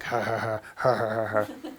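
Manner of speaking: low, soft mock chuckle; low pretend ha-ha-ha; imitated dumb laughter. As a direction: imitate men chuckling at potty humor. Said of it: "always think bathroom humor is funny".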